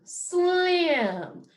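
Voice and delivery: blended back together. Shown as a demonstration. Teacher putting sounds together for the class, blended. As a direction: join the sounds into slim. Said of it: "What sounds do you hear?".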